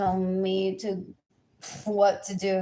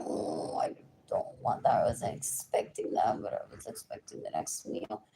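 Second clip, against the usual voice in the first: hushed whisper, much lower and softer; breathy retold reply; 13 semitones lower, 9 dB quieter than usual. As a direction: whisper it as if confiding. Retelling her own reply at dinner.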